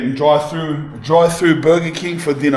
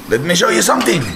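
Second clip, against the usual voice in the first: higher and a touch louder, eager; showing off a find to viewers, eager; excited announcement; 6 semitones higher, faster than usual. Shown as a demonstration. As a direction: say it again with a lift, like a reveal. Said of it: "repeated louder".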